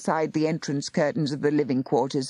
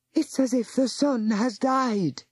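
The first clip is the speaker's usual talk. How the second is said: frightened cry; 8 semitones higher than usual. A narrator's reading. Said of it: "Missus Gregson cried".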